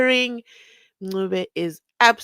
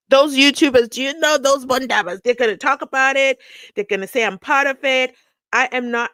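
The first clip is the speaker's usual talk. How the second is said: louder, heated protest; furious refusal; 5 semitones higher, 6 dB louder than usual. Retold or imitated. Judge refusing to take part, voiced loud and angry. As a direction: hit back at the YouTubers with irritation. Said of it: "he was furious".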